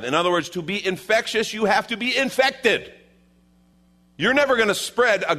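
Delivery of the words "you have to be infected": ordinary talk, nothing marked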